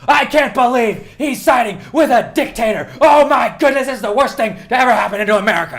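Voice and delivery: high, loud hysterical pundit; hammed-up cable-news panic at full pitch; outraged news pundit. Podcaster mocks the other channel's horror at the deal, hysterical outrage. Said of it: "you change it to the other one. They're like".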